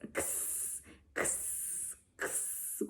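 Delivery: kss as a breathy unvoiced hiss; X sound for kids; whispered X sound. Shown as a demonstration. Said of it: "make that sound like Felix".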